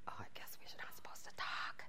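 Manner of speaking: unvoiced hushed whisper. Whispering to her friend in a silent class, hushed whisper. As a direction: whisper so the class can't hear.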